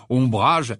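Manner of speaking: plain reading voice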